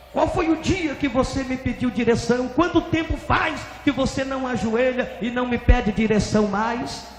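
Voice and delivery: high, insistent questioning; divine rebuke. Retold or imitated. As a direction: demand an answer from him. God interrogates the pastor.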